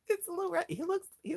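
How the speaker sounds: tiny rat greeted in a squeak; cutesy high voice. Real, not acted. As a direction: coo it like talking to a pet. Cooing over rat toy.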